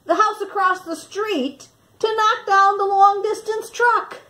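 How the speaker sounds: ordinary delivery, nothing marked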